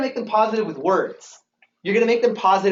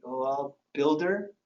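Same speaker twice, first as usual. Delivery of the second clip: much lower and softer, embarrassed; sheepish mumble; 7 semitones lower, 9 dB quieter, slower than usual. Retold or imitated. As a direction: admit it quietly, caught out. Sheepish admission of low rank.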